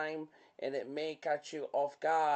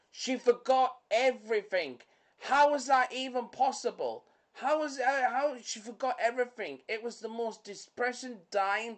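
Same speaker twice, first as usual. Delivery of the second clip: high, loud, disbelieving outburst; can't believe she forgot everything; incredulous rant; 7 semitones higher, 4 dB louder than usual. Real, not acted. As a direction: pitch it up in outraged disbelief. Ranting about The Notebook.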